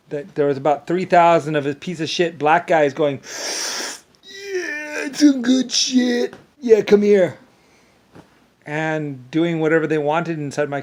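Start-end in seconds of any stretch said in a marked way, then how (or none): 3.2-7.4 high, drawn-out mocking caricature; hammed-up jeering imitation; mock crowd voice. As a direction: sneer it out in a put-on high voice.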